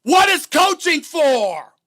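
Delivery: loud, high, slow retold outburst; mock scream. Debater throwing his rival's words back at him, screamed imitation. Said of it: "weren't you the one that screamed".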